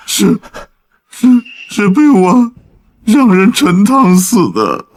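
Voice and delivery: stammered, reluctant admission with breaks; halting confession. Acted. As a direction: struggle to get the guilty words out. Captive haltingly confesses the killing.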